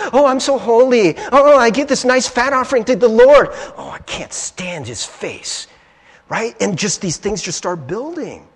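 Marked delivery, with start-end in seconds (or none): 0.1-3.5 higher, louder mock-pious sing-song; put-on pious boasting, dripping with mockery; mocking imitation. Mocking the smug brother. 3.8-5.7 low, slow, quiet loathing; acted private loathing, drawn out; seething disgust. Inner voice seethes at brother.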